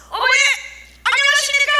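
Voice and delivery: high, shouted hello; loud greeting. Acted. Team leader greeting the temple grandmothers, loud high call.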